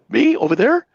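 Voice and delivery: playing dumb. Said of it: "And I go".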